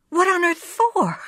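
high, amused disbelief; incredulous. Acted. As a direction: ask it with a laughing lilt. Wife incredulous.

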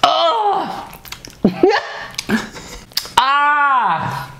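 high laughing yelps; sour shock yelp. Real, not acted. Sour candy hits.

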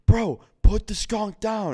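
high, loud, drawn-out mock scream; imitated scream. Party host to drunk guest through cracked door, imitated scream. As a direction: scream it slowly, stretching the words, as a retold outburst. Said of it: "And he screams it".

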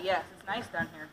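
ordinary delivery

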